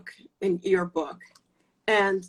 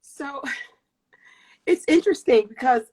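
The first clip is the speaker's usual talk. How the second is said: tearful, with a small laugh; crying; 4 semitones higher, 6 dB louder than usual. Real, not acted. Guest begins her answer to the host, still crying. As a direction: start answering through happy tears. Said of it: "I need to stop crying".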